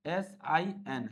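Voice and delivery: एस आई एन dictated quietly and very slowly; soft, slow dictation of एस आई एन; slow spelling. Shown as a demonstration. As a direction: spell एस आई एन softly, letter by letter. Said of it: "छोटा एस रहता है".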